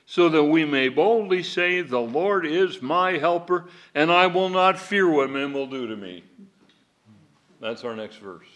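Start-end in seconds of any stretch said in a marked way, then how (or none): 0.1-6.2 bold reading. Acted.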